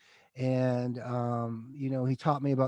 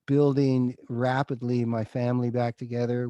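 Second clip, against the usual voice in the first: ordinary talk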